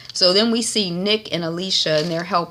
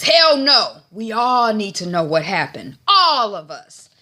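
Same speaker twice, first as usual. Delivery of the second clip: emphatic, louder acted refusal; loud protest; 5 semitones higher, 6 dB louder than usual. Man in the group demanding answers, loud protest. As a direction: push back hard, voice jumping up.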